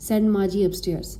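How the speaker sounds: ordinary delivery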